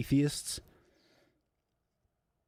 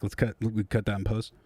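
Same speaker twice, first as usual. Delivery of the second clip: normal talking voice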